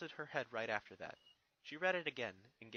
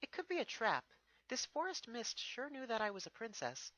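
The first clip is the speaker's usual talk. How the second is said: higher, lightly voiced young mare; a thoughtful pony princess, pitched up; Twilight's voice; 10 semitones higher than usual. Twilight answers Spike, lightly voiced and higher.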